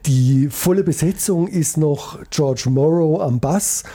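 usual speaking voice

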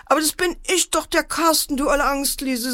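lightly voiced boy, high and quick; teasing brother. A narrator's reading. Brother reveals himself to scared sister, teasing. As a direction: blurt it out high and fast, mildly mocking.

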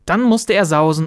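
usual speaking voice

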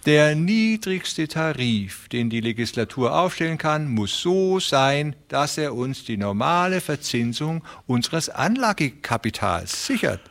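high, friendly, persuasive voice; smooth fat railway man. A narrator's reading. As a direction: talk them round with oily politeness. Beermann voice again.

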